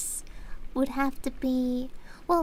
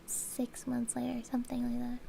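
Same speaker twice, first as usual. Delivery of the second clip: soft unvoiced whisper; 2 semitones lower, 9 dB quieter than usual. Real, not acted. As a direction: whisper it as an aside. Aside dropped to a whisper.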